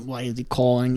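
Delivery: a tired mentor sighing at the phone; reluctant mentor Jokic. Retold or imitated. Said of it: "this reluctant mentor".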